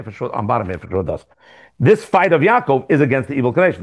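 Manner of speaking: ordinary delivery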